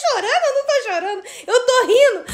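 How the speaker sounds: high, giggly retold denial; incredulous retort, cracking up; laughing protest. Own retort, laughing as she retells.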